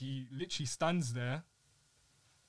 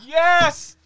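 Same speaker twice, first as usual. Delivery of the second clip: sudden excited yell of recognition, high and loud; shout; 14 semitones higher, 18 dB louder than usual. Real, not acted. Recognising the teacher his friend names, a loud shout. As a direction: blurt it out at full volume. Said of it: "Sorry for shouting".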